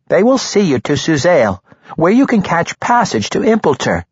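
quick eager halfling. A narrator's reading. Halfling Regis gives directions.

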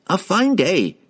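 bright, cheery high greeting; cheerily. A narrator's reading. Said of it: "he said cheerily".

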